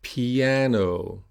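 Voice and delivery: "Piano" with I and A both clear; "Piano" with a long, stressed second vowel; repeat-after-me model. Shown as a demonstration. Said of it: "So repeat after me".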